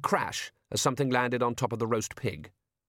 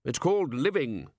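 short; 6 semitones higher, 3 dB louder, slower than usual. A narrator's reading. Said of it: "said the Arch-Chancellor shortly".

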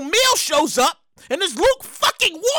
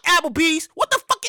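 ordinary talk

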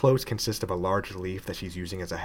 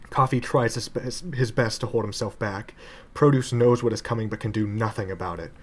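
ordinary delivery, nothing marked

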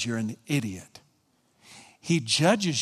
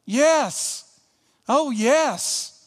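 delighted exclaim; 9 semitones higher, much slower than usual. Real, not acted. Preacher rejoicing aloud before the congregation, high and joyful. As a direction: cry out in delight.

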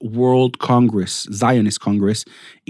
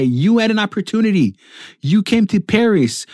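retold angry shout; 7 semitones higher than usual. Doctor losing it at immigrant parents, high retold shouting. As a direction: imitate an exasperated doctor berating them, voice pitched well up. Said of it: "begins to shout at them and say".

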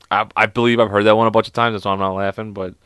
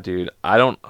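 normal talking voice

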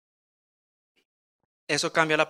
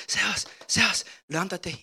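higher, urgent call to wake a son; mom calling urgently; 6 semitones higher than usual. Retold or imitated. Mother waking her son at dawn, urgent call. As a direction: call him out of sleep, pressing.

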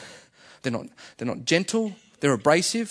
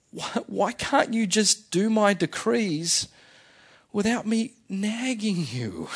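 God voiced as a pleading parent, high and slow; emotional pleading; 5 semitones higher, slower than usual. God asking his people to keep the decrees, emotional. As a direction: plead slowly, pitched up, like an exasperated parent. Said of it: "was that God getting emotional".

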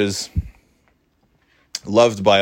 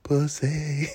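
soft, higher teasing hiss; imitated secret taunt; 5 semitones higher, 7 dB quieter than usual. Dad teasing his bothered son, soft imitated taunt. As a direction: needle him softly, drawn out. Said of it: "then, of course, he was like".

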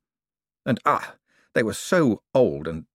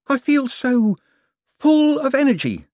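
high, loud, giddy and overexcited; energised Clamp; 5 dB louder than usual. A narrator's reading. Clamp to Moist, giddy with electric energy. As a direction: burst with manic energy.

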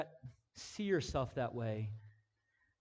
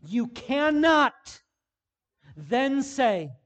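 louder, higher, driving sermon delivery; full-force preaching; 9 semitones higher, 11 dB louder, much slower than usual. Acted. Pastor urging the congregation on, full-force preaching. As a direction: preach it hard to the room.

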